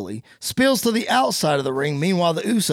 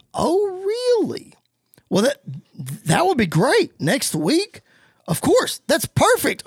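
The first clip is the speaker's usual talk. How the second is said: excited; 9 semitones higher than usual. Retold or imitated. Miz delighted on phone.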